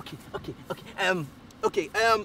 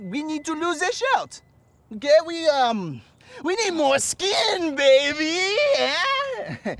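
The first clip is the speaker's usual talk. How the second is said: fake French accent; 6 semitones higher, slower than usual. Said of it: "what happened to your accent".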